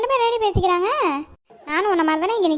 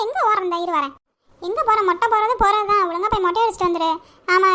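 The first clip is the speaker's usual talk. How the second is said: normal talking voice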